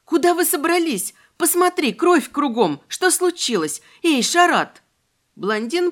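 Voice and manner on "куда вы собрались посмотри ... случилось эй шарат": high, rushed panicked questions. A narrator's reading. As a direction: fire off questions in alarm. Heroine panics over bloody friends.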